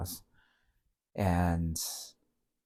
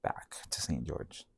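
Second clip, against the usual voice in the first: soft unvoiced whisper, lowered away from the mic; whispered aside; 4 semitones lower, 10 dB quieter than usual. Real, not acted. Telling friends he'd have stayed stuck, whispered. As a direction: let it drop to a breathy confiding whisper.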